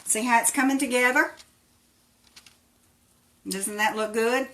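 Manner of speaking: ordinary talk, nothing marked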